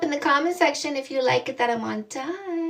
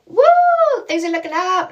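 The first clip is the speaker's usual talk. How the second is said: mock cheer; 6 semitones higher, 10 dB louder, faster than usual. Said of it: "they're like".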